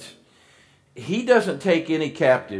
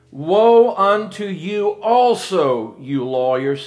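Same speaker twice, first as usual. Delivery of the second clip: higher, louder, slow condemnation; forceful rebuke; 5 semitones higher, 5 dB louder, slower than usual. Acted. Jesus condemning the lawyers, loud and slow. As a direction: thunder the woe at them.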